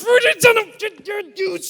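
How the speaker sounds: high, loud sputtering near-curses; mock furious, strangled high shouting; Joe Pesci. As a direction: sputter in comic rage, swallowing the swear words. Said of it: "she makes me sound like Joe Pesci from Home Alone".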